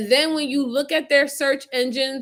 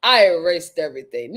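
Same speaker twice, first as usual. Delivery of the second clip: mock boast; 6 semitones lower, 5 dB louder than usual. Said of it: "as if".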